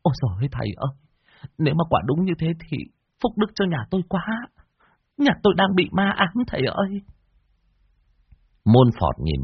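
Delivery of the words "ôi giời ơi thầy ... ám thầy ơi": put-on high, whining old woman's plea; whimpering plea. Widow pleading with the master, high and whimpering. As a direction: beg the master on the verge of tears. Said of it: "Bà Tu mếu máo".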